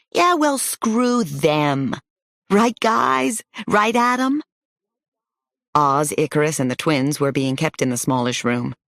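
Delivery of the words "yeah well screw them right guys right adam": put-on defiant kid. Kid defies the grown-ups' new name.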